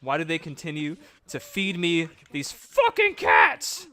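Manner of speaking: slow, loud, drawn-out complaint; exasperated rant. Real, not acted. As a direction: rant at the game.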